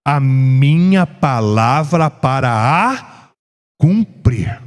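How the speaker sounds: slow, deliberate declamation with long pauses; measured, emphatic pulpit reading; solemn scripture reading. Acted. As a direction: proclaim each phrase of the verse separately. Reads scripture aloud.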